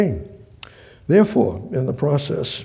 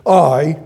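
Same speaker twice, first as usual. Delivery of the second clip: "I" loud and set apart; loud, isolated "I"; broken-up sentence; 7 dB louder than usual. Shown as a demonstration. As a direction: hit "I" hard, then stop. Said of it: "it would go like this".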